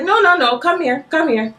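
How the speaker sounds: high, quick, insistent summons; urgent calling over. Retold or imitated. Retold calling someone over urgently.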